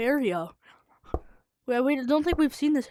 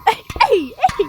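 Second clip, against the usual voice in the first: shouting; 10 semitones higher, 6 dB louder than usual. Real, not acted. Yelling to friend.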